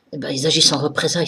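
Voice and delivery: normal talking voice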